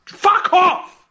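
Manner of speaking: sudden loud, high swearing shout; annoyed outburst. Real, not acted. Host losing his train of thought, annoyed outburst. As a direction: drag it out in a frustrated yell. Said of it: "I'm getting annoyed".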